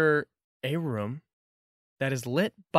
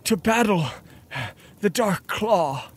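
frightened cultist; 7 semitones higher, 5 dB louder than usual. Acted. GM voices the cultist.

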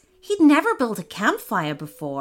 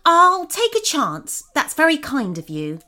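put-on higher, louder young prince; 7 semitones higher, 4 dB louder than usual. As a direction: accept the offer with resolve. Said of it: "and then he said".

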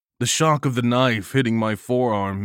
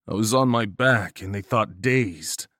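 ordinary delivery

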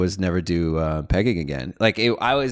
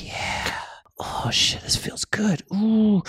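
breathy pleasured whisper; whispered pleasure; 12 semitones higher, 4 dB quieter, much slower than usual. Retold or imitated. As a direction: whisper it like it feels good. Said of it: "I was like".

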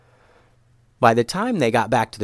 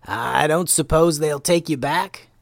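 higher, slow, tentative question; slow Momo voice; 5 semitones higher, slower than usual. Acted. Momo asks the outcast boy, slow character voice. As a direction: ask gently drawn-out in a put-on voice.

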